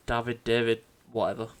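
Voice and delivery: usual speaking voice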